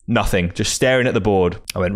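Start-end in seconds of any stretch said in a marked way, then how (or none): none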